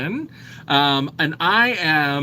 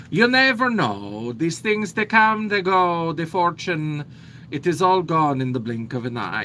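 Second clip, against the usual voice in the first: put-on light Italian accent; 3 semitones higher than usual. Slips back into contessa voice.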